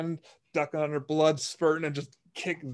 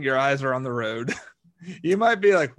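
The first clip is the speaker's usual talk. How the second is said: normal talking voice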